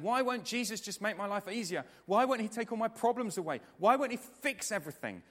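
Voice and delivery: high, loud protesting questions; frustrated complaint. Acted. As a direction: complain in exasperation, hitting each why. Acted frustrated complaint to Jesus.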